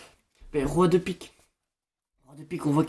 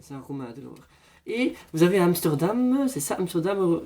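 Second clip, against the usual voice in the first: usual speaking voice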